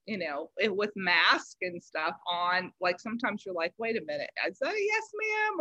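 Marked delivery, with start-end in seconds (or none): 3.8-5.6 much higher, hushed questioning; mock puzzled double take. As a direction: squint at what you heard. Mishearing through a mask.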